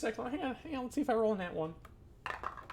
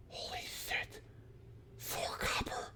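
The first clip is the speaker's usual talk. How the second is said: hushed, breathless amazement; awed whisper; 15 dB quieter, slower than usual. Acted. The kid whispering in awe at the four copper. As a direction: whisper it like a treasure.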